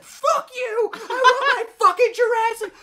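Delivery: teen screaming at his brother over a forgotten meal, hammed-up tantrum; tantrum yell. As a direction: reenact a teen meltdown at full pitch. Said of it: "when you saw Jarrett lose it".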